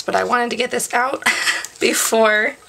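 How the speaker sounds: usual speaking voice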